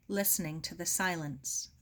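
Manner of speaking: usual speaking voice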